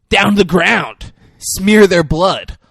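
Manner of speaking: high, loud, menacing cheer; mock bloodthirsty cheerleader chant; crazy cheerleader. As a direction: chant it loud, drawing out the end.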